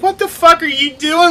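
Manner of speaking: very high, loud reenacted shout, laughing; laughing, high-pitched mock outrage; retold yelling. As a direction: yell at an intruder through laughter. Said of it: "I hear you yelling at him".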